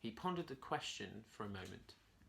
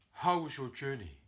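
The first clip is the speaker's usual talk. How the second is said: king asking; 2 semitones higher, 4 dB louder than usual. A narrator's reading. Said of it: "the king asked".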